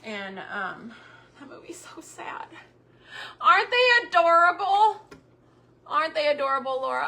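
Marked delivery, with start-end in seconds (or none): 1.4-2.5 whispered, higher aside; whispered sympathy about a sad movie. Real, not acted. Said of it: "whispered aside". 3.4-7.1 high, much louder delighted gush; gushing to viewers over adorable cups. Real, not acted. Gushing over cute item.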